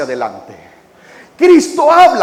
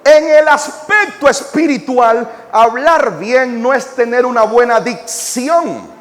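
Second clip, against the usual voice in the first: louder, higher, fiery pulpit delivery; full-force preaching; 9 semitones higher, 4 dB louder than usual. Acted.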